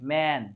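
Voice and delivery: "मैन" low, with a relaxed ए; low-key "मैन", vowel kept as ए; unaccented ए vowel. Shown as a demonstration. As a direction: say "मैन" without opening or stressing the vowel. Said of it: "accent नहीं था".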